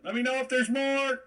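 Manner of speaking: high, loud shout to someone away; yelling out. Acted. Player's character yells out to her companion, yelling. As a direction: holler it up high with a sustained finish. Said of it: "I'll yell out to her".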